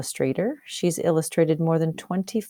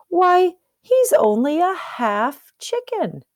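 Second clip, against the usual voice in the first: high, slow, lightly voiced turkey; 13 semitones higher, 4 dB louder, slower than usual. Turkey scoffing at the new chick, high and slow. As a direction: drawl it out like a gossiping turkey. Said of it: "one of the turkeys added".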